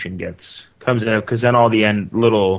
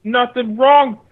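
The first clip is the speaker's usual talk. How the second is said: glitchy bitcrushed digital voice; garbled like a bad mic; eight-bit voice; 13 semitones higher, 5 dB louder, slower than usual. Real, not acted. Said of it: "You sound horrible".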